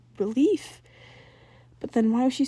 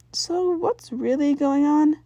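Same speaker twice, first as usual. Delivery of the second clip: casual; 6 semitones higher, 5 dB louder, slower than usual. A narrator's reading. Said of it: "she asked casually".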